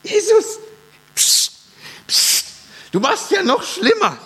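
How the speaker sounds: high, frantic hushing of Jesus; anxious disciples hissing psst at Jesus; panicky disciples. Retold or imitated. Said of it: "in meinem Film wäre das so, die Jünger".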